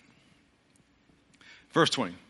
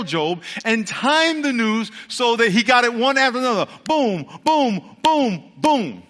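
loud, higher, rolling sermon cadence; full-force preaching; 6 semitones higher, 4 dB louder than usual. Acted. Preacher to the congregation, full-force preaching. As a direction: drive the story home from the pulpit.

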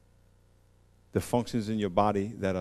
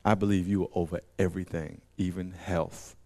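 softer, pleading leper; a leper begging Jesus, softer; humble leper plea; 6 semitones lower, 6 dB quieter than usual. Retold or imitated. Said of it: "This leper says".

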